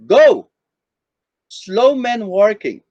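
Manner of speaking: slow, loud "go", break, then "slow men working"; pause after GO. Shown as a demonstration. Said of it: "depending on how you say it".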